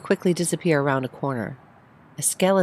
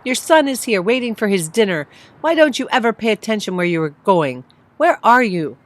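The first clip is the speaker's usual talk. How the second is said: louder, higher, scolding; scolding yell; 6 semitones higher, 6 dB louder than usual. Acted. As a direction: berate her for getting lost. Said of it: "he yelled".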